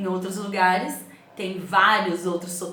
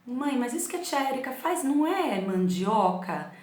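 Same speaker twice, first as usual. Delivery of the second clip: daughter's question; 6 semitones higher than usual. Retold or imitated.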